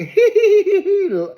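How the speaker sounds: high-pitched mocking giggle, held out; loud, high tittering laugh put on; imitated cackle. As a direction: mimic mean girls cracking up. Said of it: "Lisa and Bronwyn is cracking up like".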